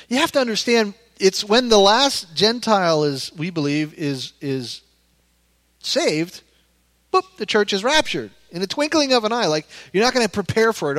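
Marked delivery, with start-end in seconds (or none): none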